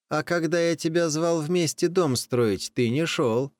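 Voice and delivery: high, scolding cat voice; cat scolds the rooster, high and reproachful. Acted. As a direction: reproach him for not helping.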